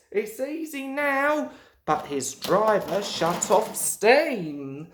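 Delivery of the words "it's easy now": happy relief. A narrator's reading. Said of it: "he thought".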